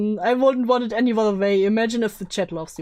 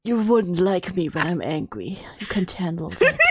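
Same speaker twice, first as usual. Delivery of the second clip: low mock-menacing boyfriend; deep man voice; 5 semitones lower than usual. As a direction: warn them off in a put-on low voice. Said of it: "doing a deep man voice or something".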